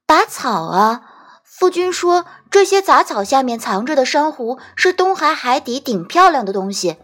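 put-on high-pitched little child voice; small child's piping chatter; little boy. A narrator's reading. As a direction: voice an innocent small boy explaining himself.

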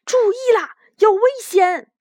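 high, loud alarm call; urgent warning. A narrator's reading. Banban alerts the other rabbits to danger, urgent warning.

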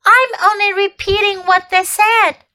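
high, frightened stammer. A narrator's reading. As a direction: stammer it out in fear. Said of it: "stammered Edmund".

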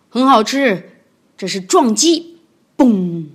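响亮有力的示范口吻; 像师父示范招式; 教学的霸王龙. Acted. 霸王龙教本领. (霸王龙大声示范)

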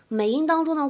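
ordinary talk

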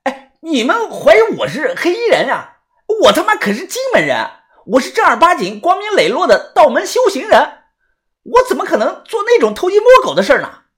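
high, indignant voice; outraged young man defending himself, pitched high; agitated protest. A narrator's reading. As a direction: protest your innocence in a worked-up high pitch. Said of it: "小道士很是激动".

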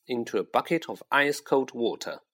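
plain reading voice

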